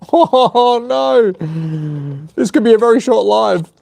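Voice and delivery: high, laughing dismay; nervous laugh. Real, not acted. Fears he broke the unit.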